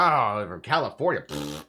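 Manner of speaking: sneering mimic of his own words; mocking Texan echo. Texan mocking a Californian tourist, lightly sneering. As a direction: parrot it back with a scoff. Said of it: "They'll be like".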